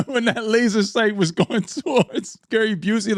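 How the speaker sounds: laughing through the words, pitch jumping up. Real, not acted. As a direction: giggle it out while describing. Laughing while recounting scene.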